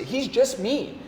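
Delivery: ordinary talk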